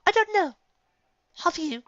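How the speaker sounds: very high, dainty lady retort; high, arch two-word return; coy comeback. A narrator's reading. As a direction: bat it back sweetly. Rarity's cliched comeback.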